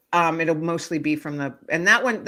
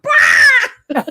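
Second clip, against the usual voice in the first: high, loud laughing screech; bird screech; 13 dB louder than usual. Retold or imitated. Retelling the cardinal screeching at her, loud high screech. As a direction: shriek like an angry cardinal. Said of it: "Screeched so loud".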